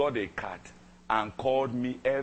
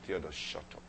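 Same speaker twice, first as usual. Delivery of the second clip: low, much softer hushing; soft rebuke; 5 semitones lower, 9 dB quieter than usual. Retold or imitated. The ex-girlfriend silencing Theodore, softly. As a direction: quietly shut him down.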